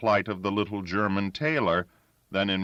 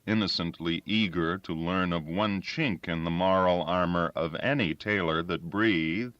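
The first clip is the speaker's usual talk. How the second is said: plain reading voice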